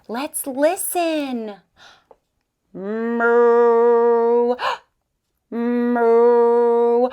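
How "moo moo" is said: low, loud mooing cow. Pretend. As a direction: moo like a big cow. Cow sound, teacher imitates.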